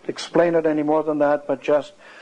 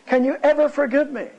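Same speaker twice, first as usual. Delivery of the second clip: high, imploring retold question; imitating a son pleading with his mother; tearful plea; 12 semitones higher than usual. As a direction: beg forgiveness, voice raised high. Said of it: "he broke down weeping".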